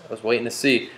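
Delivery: ordinary talk, nothing marked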